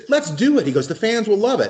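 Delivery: high-pitched imitation voice; Ace. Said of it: "he said".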